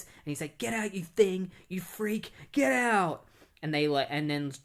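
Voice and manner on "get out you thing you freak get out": higher, louder furious shout at his daughter; dad screaming at the Supergirl he won't accept; angry father yelling. Retold or imitated. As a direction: throw her out of the house, snarling.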